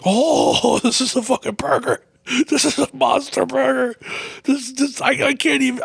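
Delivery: high, astonished voice; shocked high-pitched disbelief; amazed. Retold or imitated. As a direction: marvel at the monster burger. Amazed by huge burger.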